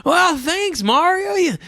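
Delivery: high, startled Luigi. Retold or imitated. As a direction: squeak out a startled thank-you high up. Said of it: "Luigi's like".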